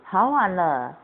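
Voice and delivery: usual speaking voice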